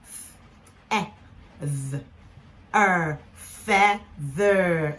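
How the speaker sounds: "f", "e", voiced "th", "er", then slow "feather"; sounded out. Shown as a demonstration. Teacher repeating the blend for learners, sounded out. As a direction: segment "feather" with pauses, then blend it slowly. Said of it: "so let's go f".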